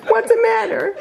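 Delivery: laughing. Real, not acted. Teasing Jordan over bad taste.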